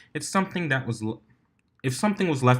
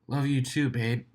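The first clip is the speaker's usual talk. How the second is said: cheeky; 4 semitones higher than usual. Acted. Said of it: "responded Soren cheekily".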